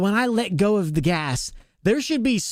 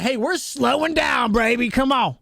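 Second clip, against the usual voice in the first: playful voicing of a truck, building to a higher, shouted finish; mock truck scream; 4 semitones higher than usual. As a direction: hype it up like the truck is yelling at you, ending in a shout. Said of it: "I want it to scream at me like".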